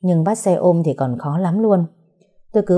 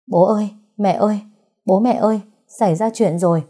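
panicked calling; 3 semitones higher than usual. Acted. Wife wakes in-laws, panicked.

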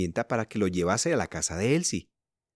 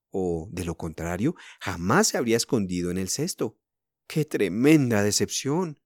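ordinary delivery

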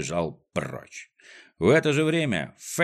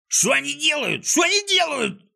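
very high, panicked shriek; frantic screeched alarm; horrified yelling. A narrator's reading. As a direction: shriek it in outrage, climbing higher. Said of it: "орал Клопс".

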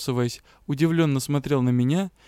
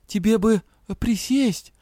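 worried; 6 semitones higher, slower than usual. Acted. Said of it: "с тревогой глядя на него".

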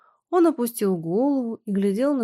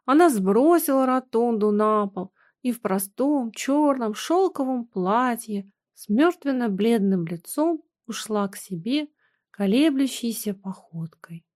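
usual speaking voice

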